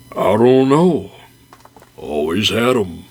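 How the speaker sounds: slow, heavy deadpan bear voice. Acted. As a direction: drop the punchline slowly. Bear's deadpan punchline.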